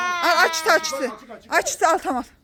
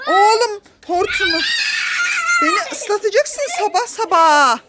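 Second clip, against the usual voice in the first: sudden high shout, slower at the end; startled shout; 10 semitones higher than usual. Real, not acted. Mother being sprayed by son's hose, high startled shout. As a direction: yelp as the water hits.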